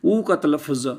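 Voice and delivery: normal talking voice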